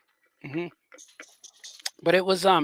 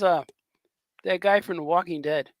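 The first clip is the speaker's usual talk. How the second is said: ordinary talk, nothing marked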